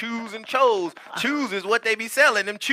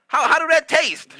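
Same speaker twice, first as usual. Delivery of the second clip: mock confusion; 7 semitones higher, 5 dB louder than usual. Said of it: "I said".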